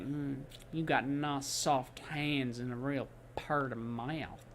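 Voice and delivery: slow, low, lazy southern drawl. Pretend. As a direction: lean into a lazy southern drawl like a hungry dinosaur. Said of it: "speaking with a southern drawl".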